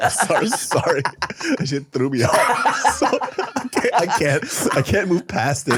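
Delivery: real giggles breaking up an apology; still laughing. Real, not acted. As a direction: try to talk through uncontrollable laughter. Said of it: "I'm still laughing".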